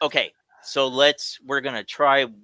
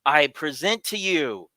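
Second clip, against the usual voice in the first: ordinary talk, nothing marked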